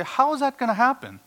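high, loud incredulous skeptic; scoffing "How is that gonna happen?" pitched high and loud; mocking doubter. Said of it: "You say".

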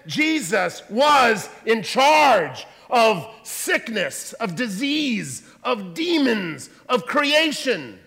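slow, loud, emphatic preaching. Real, not acted. Preacher proclaiming Jesus' authority, slow and forceful. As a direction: hammer every phrase home.

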